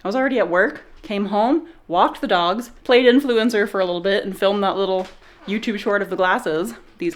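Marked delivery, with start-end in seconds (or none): none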